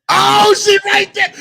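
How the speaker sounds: high, loud yell of alarm; sudden high outburst; excited shout. Real, not acted. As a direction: yell it out, pitch shooting up. Sudden shouted reaction on stream.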